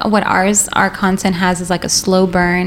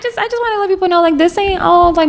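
high emphatic; 9 semitones higher than usual. Real, not acted. Exaggerated high emphatic line.

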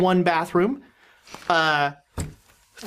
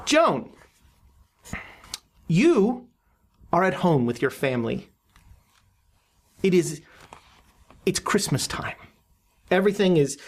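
dream narration; 4 semitones lower than usual. Acted.

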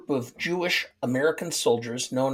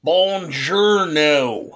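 slow, louder hammed-up Italian "Buongiorno" in a bad Italian accent; mock Italian accent; 6 dB louder, much slower than usual. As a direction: stretch out "Buongiorno" in an over-the-top fake Italian accent. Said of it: "say that that's got an Italian accent".

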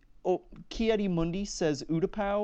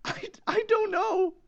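very high-pitched stumped wail; exasperated whine. Real, not acted. Contestant stumped on a Mandalorian planet question, whining. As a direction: squeal it out, helplessly stumped.

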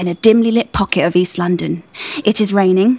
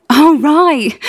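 stifled laugh; 6 semitones higher, 4 dB louder than usual. Acted. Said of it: "I suppress a laugh".

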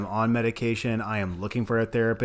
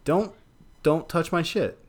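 sharp high defiant snap; 6 semitones higher than usual. Retold or imitated. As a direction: warn them off, high and sharp. Mock defiant quote.

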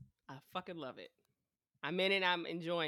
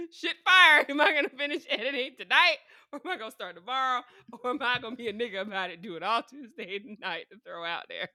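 higher, louder frantic worrying; mock panic; 5 semitones higher, 9 dB louder than usual. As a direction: fret out loud, hammed-up. Panicked self-talk about editing.

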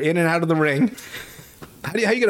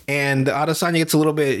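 ordinary talk, nothing marked

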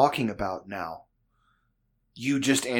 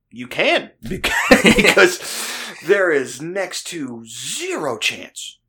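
loud, high, incredulous retort breaking into laughter; slow it right down and hammer it, half laughing; emphatic mock exasperation, raised voice; exasperated retort; 6 semitones higher, 7 dB louder, much slower than usual.

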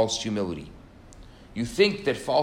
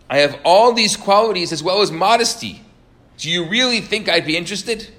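arrogant boast; 6 semitones higher, 8 dB louder than usual. Retold or imitated. Arrogant student scorns the match.

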